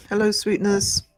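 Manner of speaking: ordinary delivery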